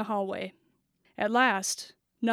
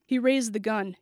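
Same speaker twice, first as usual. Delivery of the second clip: ordinary delivery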